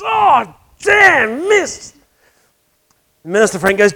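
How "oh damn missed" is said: high, frustrated golfer's cry; exasperated curse. Retold or imitated. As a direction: yelp it out as the putt stops short. Golfer misses again, curses.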